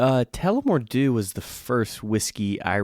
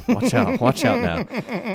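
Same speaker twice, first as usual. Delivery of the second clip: mock warning; 11 semitones higher, slower than usual. Joking warning about drinking.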